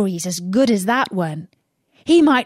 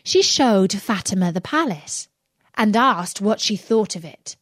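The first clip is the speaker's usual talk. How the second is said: plain reading voice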